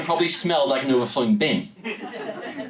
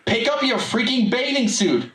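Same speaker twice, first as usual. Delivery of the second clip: mom scolding; 8 semitones higher than usual. Retold or imitated. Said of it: "added Luke's mom".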